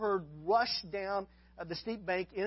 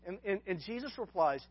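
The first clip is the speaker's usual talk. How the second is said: usual speaking voice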